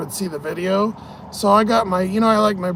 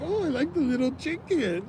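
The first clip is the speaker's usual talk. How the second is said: put-on high, soft Mexican-accented woman; softly squeaked Mexican accent impression; Sofia Vergara; 8 semitones higher, 8 dB quieter than usual. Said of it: "a Sofia Vergara kind of Mexican voice".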